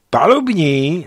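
loud, high call. A narrator's reading. Erikson calls the deck after smelling something, loud call.

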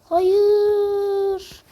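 high, loud cry of no; shouting no. Real, not acted. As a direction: yell it in dismay. Gamer cries out, failing.

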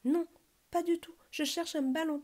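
high-pitched earnest denial; protesting. A narrator's reading. Bear accused by the stork, protesting innocence. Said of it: "protesta Petit ours mal peigné".

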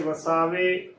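ਵਸਾਵੇ as "va-saa-ve"; wrong three-syllable reading. Shown as a demonstration. Teacher showing the congregation's mistake, ਵਸਾਵੇ as "va-saa-ve". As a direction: join e directly to the last v of ਵਸਾਵੇ. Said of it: "ਉਚਾਰਨ ਨਹੀਂ ਕਰਨਾ".

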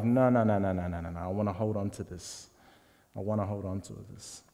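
low, soft stubborn no's; clinging refusal. Acted. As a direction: murmur it like clutching your treasure. Rich man refusing to give up.

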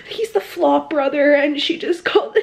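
gasp, then laugh through it; delighted shock, laughing. Real, not acted. Thrilled at Belly's insult to Jeremiah, laughing.

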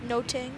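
soft "No ting" with a T for TH; imitating Alex. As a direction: quietly turn the TH to T in "No ting". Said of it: "as Alex would say".

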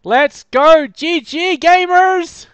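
high, loud, drawn-out cheer; excited shout. Real, not acted. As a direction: stretch out the victory cry. Celebrating a win.

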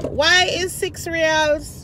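loud, high, slow outrage; indignant rant. Real, not acted. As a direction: vent about being overcharged. Ranting about the price.

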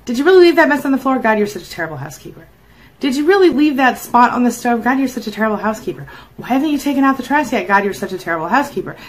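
rapid-fire mock scolding, each question starting high; nagging criticism. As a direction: nag like a fault-finding partner. Acting out a critical narcissist nagging.

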